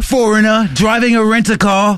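high, hammed-up British tourist; mock British accent. Imitating the British driver for the co-host, mock British accent. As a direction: put on a flustered British accent. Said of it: "old guy with a British accent".

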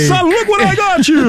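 high, hammed-up proud dad; old school dad. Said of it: "like the old school dad".

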